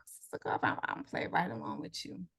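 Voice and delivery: whispered playing-along line; hushed aside. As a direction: breathe it as a secret aside. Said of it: "I'm like".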